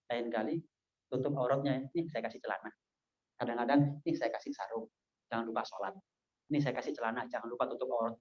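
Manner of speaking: soft, low-pitched kindly counsel; gentle advice. Acted. Preacher offering a labourer clothes to pray in, gently and quietly. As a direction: keep it low and soft, nudging without scolding.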